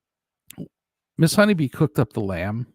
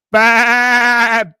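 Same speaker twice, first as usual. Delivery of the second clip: long, loud drawn-out baa of a word; bleated, long-held word; sheep-like bleat; 8 semitones higher, 10 dB louder than usual. Pretend.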